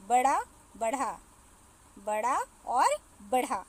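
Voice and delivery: बड़ा with a flapped ड़, बढ़ा with a breathy flapped ढ़; contrasting pronunciations. Shown as a demonstration. Teacher contrasts बड़ा and बढ़ा for pupils, carefully. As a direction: say बड़ा and बढ़ा side by side, marking the flap. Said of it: "बहुत कम अंतर होता है".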